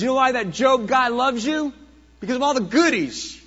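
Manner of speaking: mock God as the accuser would; Satan taunts God about Job's loyalty; taunting Satan. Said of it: "Satan stands before God and says".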